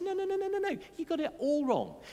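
high, rapid-fire string of no's; emphatic correction. Acted. John correcting his listeners' misunderstanding, emphatic correction. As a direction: cut in to put them right, pitched high.

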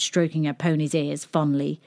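ordinary delivery

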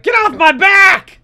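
shouting it angrily; angry shout. Retold or imitated. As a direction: yell in frustration. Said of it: "And he's like".

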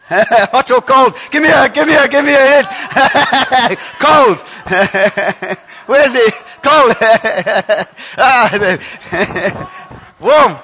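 loud, high laughing shouts; laughing calls. Acted. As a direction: shout the clues through laughter. Calling hot or cold, laughing.

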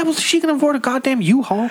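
high, loud burst of mock outrage; outraged retort. Real, not acted. Friend scoffing at a bike excuse, high outraged burst.

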